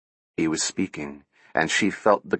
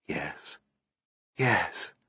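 soft breathy whisper; 4 semitones lower, 10 dB quieter than usual. A narrator's reading. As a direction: barely voice the words. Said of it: "her voice a whisper".

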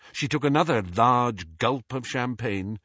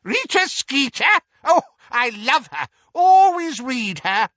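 put-on high, loud cackling old aunt; piercing, gleeful cackle of a voice; shrill cackle; 8 dB louder than usual. A narrator's reading. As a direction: crow it shrilly with relish. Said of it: "interrupted by a shrill cackle".

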